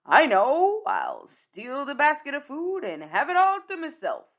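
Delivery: loud, high, gleeful villain voice; scheming Captain Hook. Acted. Hook hatching a plan to steal the food, gleeful. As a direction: crow over your plan like a pirate.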